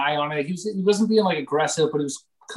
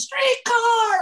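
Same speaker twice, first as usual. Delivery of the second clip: much higher imitated yell of alarm; mock warning shout. Buddy yelling warning as fighters roll toward streetcar. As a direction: call out the danger like the buddy did. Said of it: "One of my, my buddies goes".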